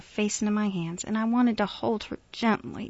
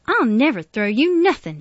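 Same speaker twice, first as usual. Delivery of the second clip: loud, higher, fervent denial; emphatic, raised-voice protest; indignant vow; 6 semitones higher, 10 dB louder than usual. A narrator's reading. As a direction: insist hotly that it would never happen. Miss Emma protests to Jefferson.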